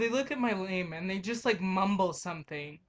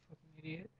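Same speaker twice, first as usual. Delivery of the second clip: nearly silent, under the breath; muttered insult; 5 semitones lower, 18 dB quieter, faster than usual. Real, not acted. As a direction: mutter it to yourself.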